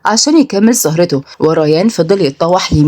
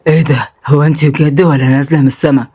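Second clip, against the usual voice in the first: low, awestruck man's voice; dazed drunk admirer; 4 semitones lower than usual. Acted. Drunk man stunned by her beauty, dazed.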